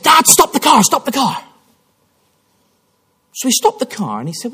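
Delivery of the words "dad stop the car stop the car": high, urgent plea to stop the car; insist, alarmed, that Dad stop. Retold or imitated. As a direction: son urging his father to stop. Son urging father to stop.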